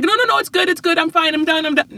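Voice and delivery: put-on high, quick protest from a kid hiding her work. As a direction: brush mom off in a high child voice. Daughter refusing to show work.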